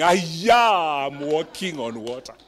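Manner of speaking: delighted, amazed realization; thrilled to be walking on water; excited Peter. Acted.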